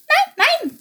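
high, quick little bird denial; hasty sparrow denial. Acted. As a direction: hurry to deny, like a startled sparrow. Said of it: "beeilte sich Chilp zu sagen".

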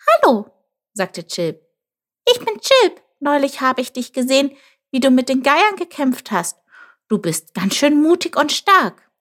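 high-pitched, eager little creature; friendly Chilp. Acted. Chilp greets the jackal he saw fighting, high and admiring. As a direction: pitch it right up for a chirpy greeting.

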